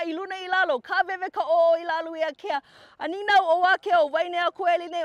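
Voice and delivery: the chant stepped up in power; intensity up. Shown as a demonstration. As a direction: raise the chant's intensity, building with each line. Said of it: "Go up".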